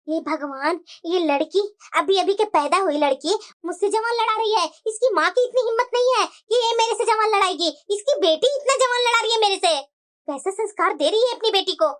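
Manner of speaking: a cranky grandma complaining about her granddaughter; scolding grandmother. Acted. Grandmother complaining about the cheeky granddaughter, low grumbling voice.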